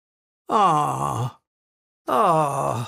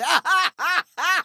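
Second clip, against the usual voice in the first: high gloating laugh; villain cackle; 10 semitones higher than usual. Acted. Sea witch after her big surprise, high gloating cackle. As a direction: laugh high, in separate ha-ha bursts.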